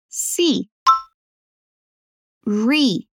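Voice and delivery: slow teaching model of "Seriously"; slow, syllable by syllable. Shown as a demonstration. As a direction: sound out "Seriously" slowly and carefully. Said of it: "repetir devagar as sílabas". (devagar, sílaba por sílaba)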